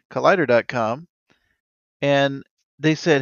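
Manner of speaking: normal talking voice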